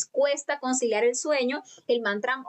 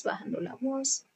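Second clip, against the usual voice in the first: bajando la voz said much softer, dropping away; lowered voice; 2 semitones higher, 6 dB quieter than usual. Shown as a demonstration. Teacher showing students how the voice fades, much softer. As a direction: let bajando la voz sink nearly to a murmur. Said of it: "después va bajando la voz".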